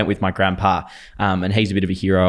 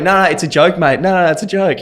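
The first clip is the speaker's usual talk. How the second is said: 1 flustered reassurance; 10 semitones higher, 6 dB louder than usual. Retold or imitated. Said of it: "And I'm going".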